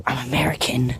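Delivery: a quick confiding whisper out of character; whispered aside. Real, not acted. Host whispering to listeners that he is really American. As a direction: drop to a whisper to confess.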